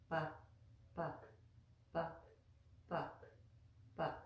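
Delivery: pa with lips closed and no puff of air; soft, gentle pa; unaspirated p. Shown as a demonstration. Teacher showing the soft Sanskrit p. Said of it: "try not to make a lot of air come out".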